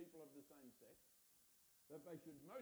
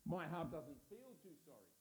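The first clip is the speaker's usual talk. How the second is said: reluctant objection; 11 dB louder than usual. Retold or imitated. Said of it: "You say".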